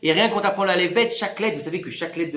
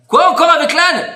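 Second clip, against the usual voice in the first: plus haut et plus fort, indigné; s'exclamer, scandalisé; protestation exaspérée; 8 semitones higher, 9 dB louder than usual. Retold or imitated. (« encore avec l'âne », lancé plus haut et plus fort)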